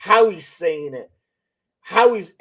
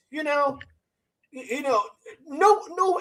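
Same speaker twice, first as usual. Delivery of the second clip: high, soft, hesitant stammer on the words; halting stammer; 7 semitones higher, 4 dB quieter than usual. Retold or imitated. Mimicking an interviewee who stumbles, halting stammer. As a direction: imitate him faltering, unsure how to say it. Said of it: "I don't wanna say stutters, but kind of like".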